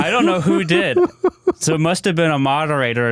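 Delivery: high-pitched, laughing protest; laughing while talking. Real, not acted. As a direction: say it through helpless laughter. Cracking up over deleted comment.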